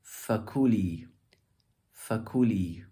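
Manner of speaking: "Fakuli" with the ku stressed, falling after; "Fakuli", peak on ku then drop. Shown as a demonstration. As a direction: lean on the middle syllable of "Fakuli". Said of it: "more eloquent would be".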